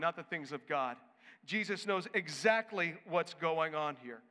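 usual speaking voice

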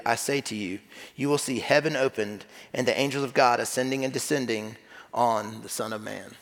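low, measured Bible reading; scripture reading. Acted. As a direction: read the passage aloud evenly and low. Reads scripture aloud.

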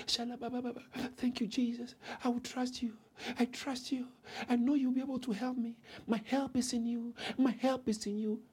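very soft, private praying voice; hushed prayer. Acted. Believer overheard praying to Jesus for help, hushed. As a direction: pray quietly, almost to yourself. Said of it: "how about they hear you".

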